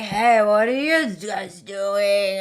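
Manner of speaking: put-on slow, drawn-out drunken greeting; drunk man. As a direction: imitate a drunk partygoer, dragging the words. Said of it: "He was, like, so drunk".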